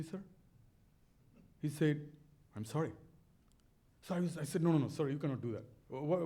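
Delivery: ordinary talk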